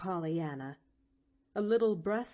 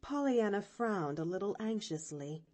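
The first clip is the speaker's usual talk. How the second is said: ordinary delivery, nothing marked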